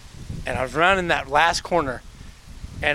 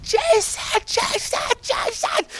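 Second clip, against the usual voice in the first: high-pitched yelled cheer for her son; mom cheering; slower than usual. Retold or imitated. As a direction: shout his name from the sidelines like a mom. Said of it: "she was just screaming".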